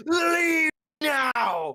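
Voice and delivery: high, loud furious yell; angry shout. Acted. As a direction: throw him out, yelling. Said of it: "She kicks you on the lesson".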